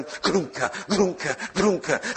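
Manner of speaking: jolting "grunka" noise, last ones dragged out; imitated power surge. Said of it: "this power sort of going".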